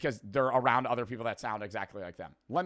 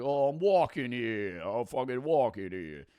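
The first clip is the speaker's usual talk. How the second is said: lightly put-on Hoboken accent. Host showing the Jersey accent he lacks, mock Hoboken accent. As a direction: imitate a stock Hoboken local, drawing out the vowels. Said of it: "Not like a Hoboken-esque one where they're like".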